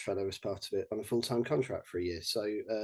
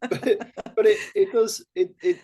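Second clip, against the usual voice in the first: higher, louder, laughing as he protests; laughing through words; 8 semitones higher, 5 dB louder than usual. Real, not acted. [name] laughing off the host's tease, laughing. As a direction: giggle through the words, recovering.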